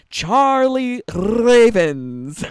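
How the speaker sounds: drawn-out, loud, high announcer call; fighting game announcer. Acted. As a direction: call the name like a Tekken announcer. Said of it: "like the Tekken eight announcer voice".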